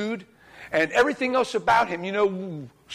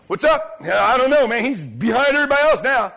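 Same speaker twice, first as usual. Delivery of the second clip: raised, higher calling voice; acted calling out; 4 semitones higher, 4 dB louder than usual. Preacher acts watchman answering the king, calling out. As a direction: play a lookout reporting down from a tower.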